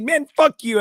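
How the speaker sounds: loud, high, indignant dismissal; retold angry outburst. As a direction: act out blowing someone off angrily.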